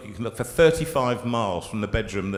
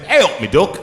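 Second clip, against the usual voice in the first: louder, cheery mock Derbyshire greeting with a rising end; 4 semitones higher, 8 dB louder than usual. Retelling greeting a bloke at the concert, in a louder cheery mock Derbyshire. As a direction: call out the Derbyshire greeting to a stranger. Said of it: "I said".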